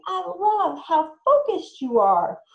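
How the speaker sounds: slow, high, loud praise; lavish warm praise for the child; praising. Shown as a demonstration. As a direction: parent praising a focused child. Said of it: "you would say".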